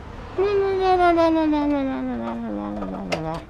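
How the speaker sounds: high, soft giggling hum; soft giggle. Real, not acted. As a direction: giggle quietly to yourself. Laughs after screw chant.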